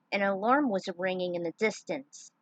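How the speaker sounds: ordinary delivery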